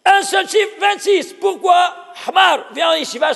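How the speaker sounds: loud rousing yeshiva invitation; triumphant challenge. Real, not acted. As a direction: challenge the student with glee. Teacher's triumphant challenge to student.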